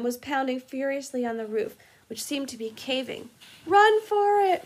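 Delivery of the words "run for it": very high, very loud shout; shouted order. Acted. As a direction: scream at them to get out. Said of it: "Ron shouted".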